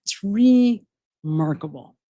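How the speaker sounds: slow, savoring remarkable; drawn out. Shown as a demonstration. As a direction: stretch out remarkable, relishing it. Repeating the word for effect.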